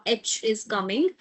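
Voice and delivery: normal talking voice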